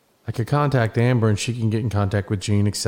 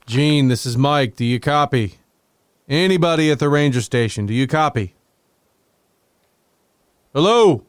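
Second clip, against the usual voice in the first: calling over walkie talkie; 4 semitones higher, 3 dB louder than usual. Acted. Calling over the radio again.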